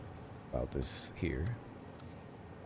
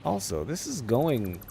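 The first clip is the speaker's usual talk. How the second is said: ordinary delivery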